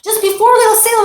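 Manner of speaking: put-on frantic parent pleading with the child; fussing parent. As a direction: fret over the new holiday clothes. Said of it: "and you say to the kid".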